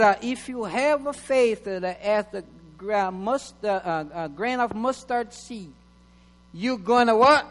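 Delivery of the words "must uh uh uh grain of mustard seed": ordinary talk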